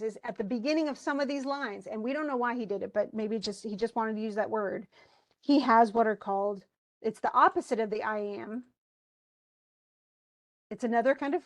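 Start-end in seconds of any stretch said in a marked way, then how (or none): none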